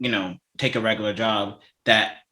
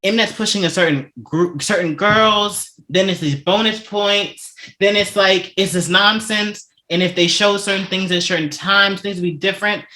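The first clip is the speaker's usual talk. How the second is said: really frustrated; 6 semitones higher than usual. Real, not acted. Host venting about the show's unfair treatment, frustrated. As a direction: vent with raised pitch, stretching the grievances out. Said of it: "I'm so frustrated".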